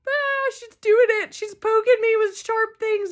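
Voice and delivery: high, laughing fake alarm; mock panic. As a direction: squeal it like a pretend fright. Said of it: "And I'm like".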